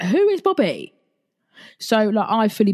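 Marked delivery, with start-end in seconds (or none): 0.0-0.9 baffled high question. Retold or imitated. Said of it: "And she was like".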